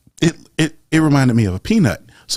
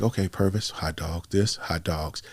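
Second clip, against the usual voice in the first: muttering to himself; 5 semitones lower, 8 dB quieter than usual. Retold or imitated. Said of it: "I was like".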